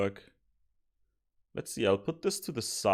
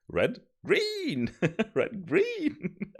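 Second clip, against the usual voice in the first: giddy; 13 semitones higher, much slower than usual. Real, not acted. Repeating the happy shout, giggling.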